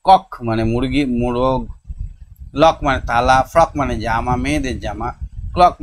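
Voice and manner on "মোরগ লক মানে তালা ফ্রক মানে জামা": usual speaking voice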